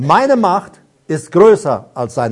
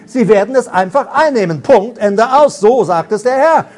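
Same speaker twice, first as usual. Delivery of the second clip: raised, driving proclamation; prophetic preaching; 4 semitones higher than usual. Real, not acted. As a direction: declare it with full conviction. Prophetic declaration.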